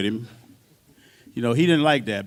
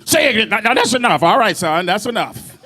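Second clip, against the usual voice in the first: loud, higher stern warning; stern shout; 8 semitones higher, 5 dB louder than usual. Retold or imitated. Bandleader cutting off the drummer, loud and stern. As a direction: shut him up, raising the voice. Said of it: "[name] ran over like Star Trek on me".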